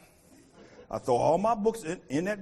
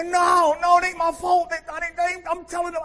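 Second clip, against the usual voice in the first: high, loud pleading yell; protesting shout; 13 semitones higher, 10 dB louder than usual. Retold or imitated. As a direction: plead your innocence loudly. Protesting as dorm chief.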